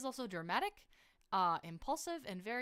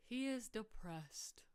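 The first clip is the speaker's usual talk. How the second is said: slow, breathy hushed sorrow; mock gloomy whisper; 4 semitones higher, slower than usual. Dramatic sad aside.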